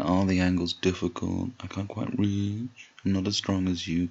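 teasing imitation of whining; mock complaining. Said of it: "don't just complain".

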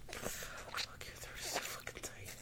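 panting whisper; breathy whisper. Acted. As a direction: gasp it under the breath. Whispered during climax build.

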